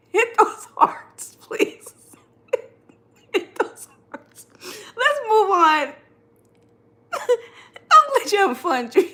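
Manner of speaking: high, slow, squeaking with laughter and tears; laughing so hard it nearly turns to crying. Real, not acted. Host begging viewers for hearts, laughing till tears come. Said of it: "you guys got me with tears in my eyes".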